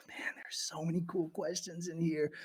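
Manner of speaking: hushed whisper. Real, not acted. Streamer whispering to chat in a dark morgue. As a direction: whisper so nothing hears you.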